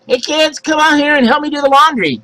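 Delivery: high call across the house; calling out to kids. Retold or imitated. Mom calling kids to help with laundry, high and ringing. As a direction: sing out for the kids to come help. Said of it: "Call that out".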